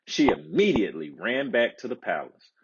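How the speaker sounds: ordinary delivery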